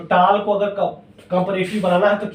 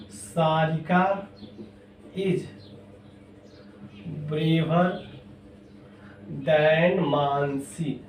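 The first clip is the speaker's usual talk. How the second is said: slow, with long pauses between words; word-by-word board dictation; dictating slowly; 3 semitones lower, 8 dB quieter, much slower than usual. Shown as a demonstration. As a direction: give the class time to write each word. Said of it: "teacher dictates example with pauses".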